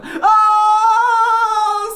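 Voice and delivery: put-on long, piercing boyish shriek; terrified scream. A narrator's reading. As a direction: scream high and hold it. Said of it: "screamed Alfie".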